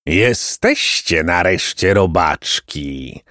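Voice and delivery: slow, low, strange creepy voice; monster's screech. Acted. Monster greets the trapped boys in a slow, low, creepy voice. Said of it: "zaskrzeczał okropny głos".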